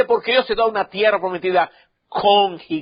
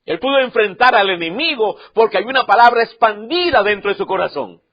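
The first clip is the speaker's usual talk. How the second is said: ordinary talk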